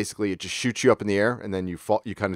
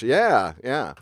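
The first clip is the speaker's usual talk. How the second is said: normal talking voice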